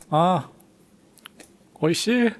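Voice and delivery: high-pitched relish; acted-out delighted foodie reaction; savoring delight. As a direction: sigh with pleasure at a first bite. Reliving the first delicious bite.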